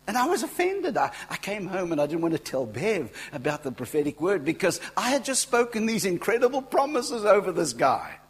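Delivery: high, loud, indignant storytelling; worked-up exclaiming. Real, not acted. Preacher reliving being offended at his own prophecy, loud indignation. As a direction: let the outrage carry the voice way up.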